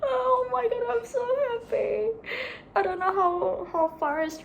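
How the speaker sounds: high, loud happy squeal; overjoyed. Real, not acted. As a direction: squeal with real joy. Overjoyed after securing tickets.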